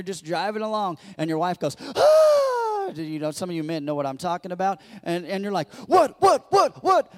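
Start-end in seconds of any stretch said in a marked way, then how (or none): 1.8-2.9 sudden loud, high imitated gasp; mock startled gasp. Wife's sudden alarm in car. 5.9-7.1 loud, high, rapid-fire alarmed yelps; mock panic. Husband reacting to wife's gasp.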